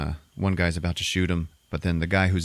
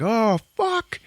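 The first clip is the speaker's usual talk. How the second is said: sudden high-pitched dismay; shocked cry. Retold or imitated. Gunmen spotting lit dynamite, high shocked cry. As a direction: yelp it as the danger dawns.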